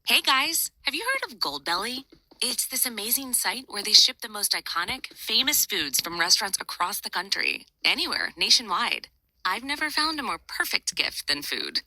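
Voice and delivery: bright, high sponsor read; upbeat ad read. Acted. Host reading a sponsor spot to viewers, upbeat ad voice. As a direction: sell it like a cheerful ad host.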